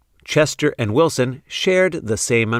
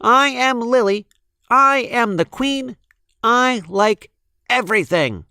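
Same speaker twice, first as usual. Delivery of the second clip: put-on much higher, proud little girl voice; high, bossy little girl voice; bold Lily; 12 semitones higher than usual. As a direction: announce yourself like a queen. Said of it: "Lily had her own way".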